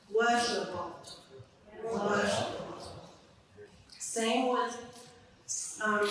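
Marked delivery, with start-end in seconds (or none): 0.1-0.7 "Washington" starting high and falling to low, loud. Shown as a demonstration. Teacher showing the tone falling across the word, loud. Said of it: "fluency bars ... tone is higher and it's lower". 1.8-2.5 "Washington" again with its falling tone; tone drop repeated. Shown as a demonstration. Teacher modelling the falling tone once more. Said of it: "fluency bars ... tone is higher and it's lower".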